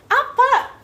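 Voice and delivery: high, loud startled "Apa?"; startled what. Real, not acted. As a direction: react in sudden surprise. Kaget baca chat. (kaget, nada tinggi dan keras)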